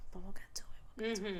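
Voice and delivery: teasing whisper. Real, not acted. Teasing later plot reveal.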